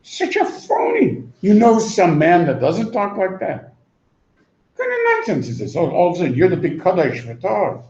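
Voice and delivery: a shrill-free mock jeer, high pitched; mocking shriek. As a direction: ridicule the phony. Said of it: "somebody says".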